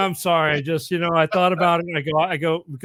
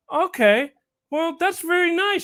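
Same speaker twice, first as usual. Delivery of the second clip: put-on high, slow sweet old lady voice; little old lady. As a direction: coo at the kid like a sweet old granny. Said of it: "she says".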